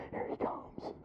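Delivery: excited hushed whisper. Retold or imitated. Hunter in his stand whispering as the buck approaches. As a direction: breathe it so the deer won't hear.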